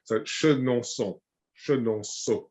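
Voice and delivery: Chenonceau said slowly, nasal French O; slow French name. Shown as a demonstration. Modelling the chateau name for the learner. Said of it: "The Chenonceau".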